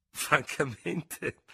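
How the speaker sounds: high, drawn-out word through a laugh; laughing interruption, stretched out slowly; innocent laugh. A narrator's reading. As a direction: laugh innocently as you cut in. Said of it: "interruppe il tenente Dubert con una risata innocente".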